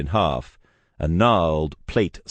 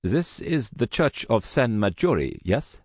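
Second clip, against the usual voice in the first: put-on accented visitor at the church door; lightly accented, polite stranger; polite menace with a Russian accent; Russian man; 6 semitones higher than usual.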